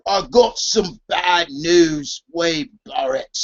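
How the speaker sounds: put-on slow, low British accent. Wrestling host imitates Wade Barrett's bad news catchphrase in a British accent. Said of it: "I'm talking to my British accent".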